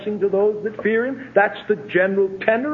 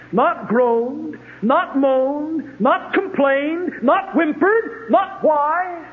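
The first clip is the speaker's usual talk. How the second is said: building fervent emphasis; 8 semitones higher, much slower than usual. Acted. Rising list of denials.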